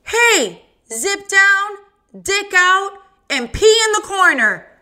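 teacher barking orders at the class; stern teacher. Acted. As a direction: call the naughty boys to order. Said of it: "The teacher comes back and says".

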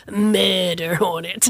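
slow, ominous movie-trailer delivery; dramatic murder hornet. Pretend. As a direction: drag it out like a horror title. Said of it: "I wanna go".

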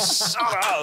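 high, loud retold outburst; mock urgent hushing. Man hushing his loud American girlfriend, mock urgent. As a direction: imitate cutting someone off, high and loud.

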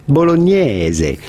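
bolognese slow, Italian vowels, bol-o-NYE-ze; Italian pronunciation. Shown as a demonstration. Radio host demonstrating bolognese the Italian way. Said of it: "typical Italian pronunciation would normally be".

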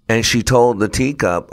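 ordinary delivery

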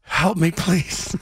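low, slow, pitiful plea; mock-helpless phone voice, drawn out and low; pleading caller. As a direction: beg feebly down the phone. Voices Eli begging on the phone.

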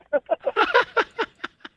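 a very high, squeaky real laugh; high-pitched giggle. Real, not acted. Caller cracking up at the host's joke, a squeaky high laugh. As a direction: let the giggle fly way up. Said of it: "your laugh sounds like you're high".

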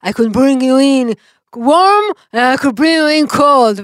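slow, loud, drawn-out movie quote; bounty hunter's threat. Retold or imitated. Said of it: "ואז הוא אומר לו". (ציטוט דרמטי, איטי ומתוח באנגלית)

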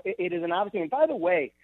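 ordinary talk

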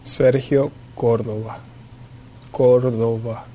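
Sergio Córdoba said slowly, stress on the first syllable of Córdoba; careful name pronunciation. Shown as a demonstration. Player shows broadcaster how his name is pronounced, careful demonstration of "Sergio Córdoba". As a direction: say "Sergio Córdoba" slowly and clearly, leaning on COR. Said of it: "could he pronounce his name".

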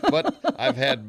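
high burst of laughter; laughing. Real, not acted. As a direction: laugh through the words. Laughs at own miscount.

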